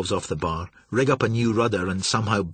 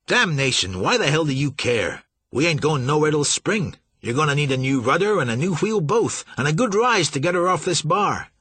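exasperated pilot; 6 semitones higher, 3 dB louder than usual. A narrator's reading. Pilot arguing loudly.

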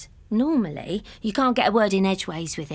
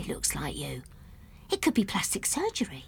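whispered; 4 semitones lower, 9 dB quieter than usual. Retold or imitated. Doctor's quiet suggestion.

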